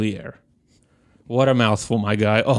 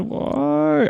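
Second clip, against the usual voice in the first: leaps far higher in despair; anguished why. Retold or imitated. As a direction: wail the word up high. Despairing at the wine rack.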